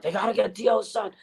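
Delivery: high, whiny mock fan demand; high fan imitation. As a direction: nag it like pushy fans. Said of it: "all these people were thinking about".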